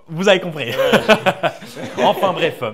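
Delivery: loud burst of laughter; laughing. Real, not acted. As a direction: laugh through the words. Cheeky joke, bursts out laughing.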